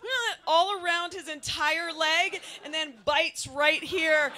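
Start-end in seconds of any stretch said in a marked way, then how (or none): 0.0-0.3 cat screech. Retold or imitated. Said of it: "she goes".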